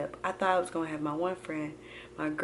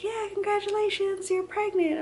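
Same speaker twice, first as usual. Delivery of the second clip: very high, chirpy imitation of the nurse; mock-sweet announcement in a high voice; congratulating nurse. Nurse telling her she is pregnant, congratulatory.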